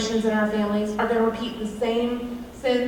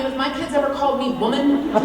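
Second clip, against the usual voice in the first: normal talking voice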